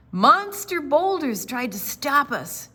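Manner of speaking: normal talking voice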